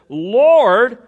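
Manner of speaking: "Lord" loud and rising, set apart; emphatic Lord. Real, not acted. Preacher driving home the point to the congregation, loud stress on "Lord". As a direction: punch the single word "Lord". Climax of the David argument.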